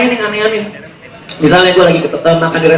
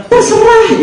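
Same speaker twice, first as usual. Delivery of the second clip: high mock-girlfriend voice, much higher than his own; girly falsetto; 13 semitones higher, much slower than usual. Hungry girlfriend answering her boyfriend, in high mock falsetto. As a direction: squeak it like a whiny girlfriend.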